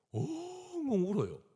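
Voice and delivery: 엉엉 sung out high and slow like a sob; a hammed-up sobbing wail; mock wailing. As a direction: imitate the wife wailing, drawn out and very high. Mimics his wife's loud sobbing.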